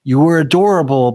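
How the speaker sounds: usual speaking voice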